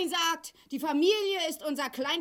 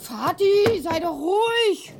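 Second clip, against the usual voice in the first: raised voice begging him to calm down; loud plea; 5 semitones higher, 6 dB louder, slower than usual. Acted. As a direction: call over him loudly, then slow down the plea. Daughter pleads with raging father.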